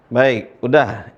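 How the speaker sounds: ordinary delivery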